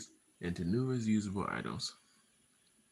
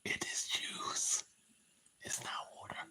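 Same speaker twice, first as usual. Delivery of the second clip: secret whisper; 4 dB quieter than usual. Pretend. Whispering to the camera that it is juice. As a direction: let them in on a secret, hushed. Joking that the bottle hides drink.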